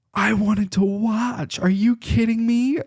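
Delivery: high-pitched mock outrage; mad, imitated. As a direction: imitate an indignant wife, drawing out the words high. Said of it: "she got so mad".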